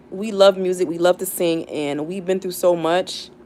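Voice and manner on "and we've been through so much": ordinary delivery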